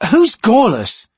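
high, loud sharp demand. A narrator's reading. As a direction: demand an answer hotly. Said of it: "he demanded, glaring".

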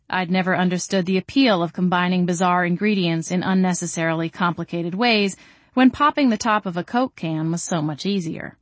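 plain reading voice

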